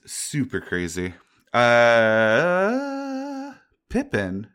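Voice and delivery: normal talking voice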